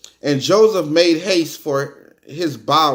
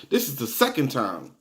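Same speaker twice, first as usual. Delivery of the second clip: ordinary talk, nothing marked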